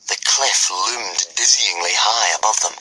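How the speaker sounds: ordinary delivery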